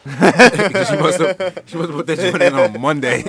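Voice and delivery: high, loud, through real laughter; laughing hard. Real, not acted. Friend cracking up over mother's early wings order, loud laughing voice. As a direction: crack up while getting the words out.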